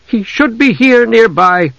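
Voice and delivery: a mild angry complaint. A narrator's reading. Desra annoyed the elf lord ran off, mildly angry. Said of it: "Desra said angrily".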